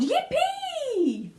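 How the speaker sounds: high, long joyful whoop; yelled yippee. Acted. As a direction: let it burst out of you. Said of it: "threw up his arms and yelled".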